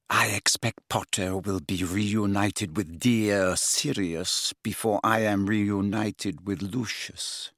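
low, soft, icy threat; cold aristocratic mother. A narrator's reading. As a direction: menace him quietly, every word measured. Narcissa's cold taunt.

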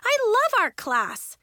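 high, loud objection; heated self-defence; protesting. A narrator's reading. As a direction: insist indignantly. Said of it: "Nori protested".

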